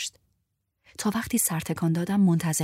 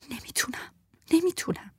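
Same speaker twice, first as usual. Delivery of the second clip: panicked refusal; 11 semitones higher, 3 dB quieter than usual. Acted. Terrified repeated refusal.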